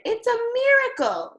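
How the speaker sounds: high, loud cry of wonder; amazed shout. A narrator's reading. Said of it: "They shouted".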